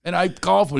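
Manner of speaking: ordinary talk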